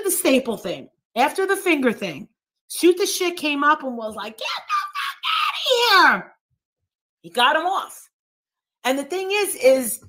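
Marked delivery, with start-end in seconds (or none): 4.4-6.4 very high-pitched hollered outburst; retold yell. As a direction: holler it high, imitating someone kicking a man out. Retold shout of someone coming up.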